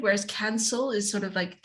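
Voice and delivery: ordinary talk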